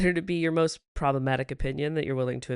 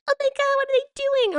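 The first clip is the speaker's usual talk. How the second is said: high, loud gushing voice; mock royal fan, high and loud; 5 dB louder, faster than usual. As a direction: squeal like an obsessed royal fan. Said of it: "They're like".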